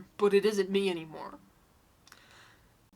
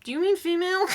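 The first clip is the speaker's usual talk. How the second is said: sarcastic; 8 semitones higher, 6 dB louder, faster than usual. Real, not acted. Mocking the typo to poster.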